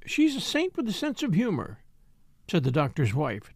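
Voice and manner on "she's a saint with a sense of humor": playful, higher-pitched wifely teasing; twinkling. A narrator's reading.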